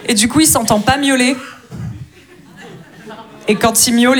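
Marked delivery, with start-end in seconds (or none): none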